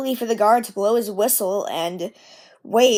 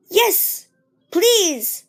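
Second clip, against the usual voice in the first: eager little engine; 8 semitones higher than usual. Acted. Edward eagerly accepts.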